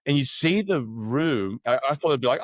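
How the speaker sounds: ordinary talk, nothing marked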